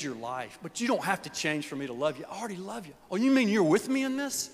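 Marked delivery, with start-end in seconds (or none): 3.1-4.5 amazed relief. Acted. Listener voiced, amazed.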